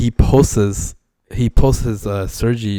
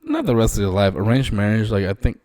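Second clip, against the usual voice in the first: normal talking voice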